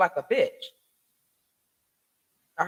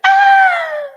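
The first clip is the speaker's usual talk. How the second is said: high piercing imitated scream, loud; a loud, high, mocking yell of pain; 13 dB louder than usual. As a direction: belt out a mocking shriek of pain.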